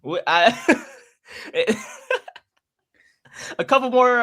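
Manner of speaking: embarrassed laughter over the words; laughing it off, a little flustered; bashful laughing. Real, not acted. Laughing through embarrassed reply.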